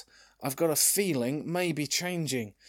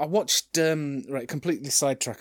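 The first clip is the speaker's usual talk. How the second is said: usual speaking voice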